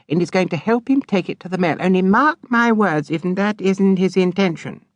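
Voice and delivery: put-on high, knowing, scornful girl's voice; spiteful sister. A narrator's reading. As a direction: sneer at the creature. Charity predicts Pinch.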